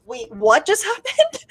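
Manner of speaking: slowed, laughing disbelief; a half-laughed, slow "what just happened"; baffled disbelief. Retold or imitated. Reliving hearing the no extradition news, laughing in disbelief. Stunned reaction to ruling news.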